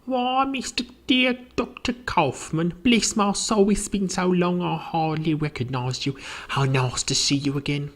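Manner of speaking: high-pitched, effusive housekeeper; welcoming housekeeper. Acted. Housekeeper welcoming Doctor Kaufmann back, high and effusive. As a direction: greet an old acquaintance in a high voice. Housekeeper greets old visitor.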